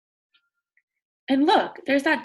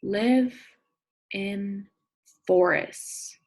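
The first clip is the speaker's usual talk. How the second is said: slow, clear read-aloud; reading to children; much slower than usual. Acted. Teacher reading a picture book to her class. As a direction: read each word out for young listeners.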